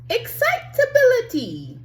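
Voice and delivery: usual speaking voice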